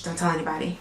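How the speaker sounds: hushed secret. Pretend. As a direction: lean in and keep it hushed.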